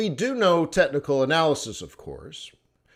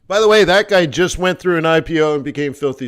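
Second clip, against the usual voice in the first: usual speaking voice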